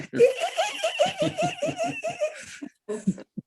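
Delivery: high whooping laugh; shouted laugh. Real, not acted. Laughing shout after the stick joke.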